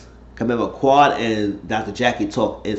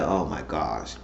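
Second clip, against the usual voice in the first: low, soft exasperated aside; retold dismay; 7 semitones lower, 9 dB quieter, slower than usual. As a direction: let it drop under your voice, mildly appalled. Storyteller's own dismayed reaction.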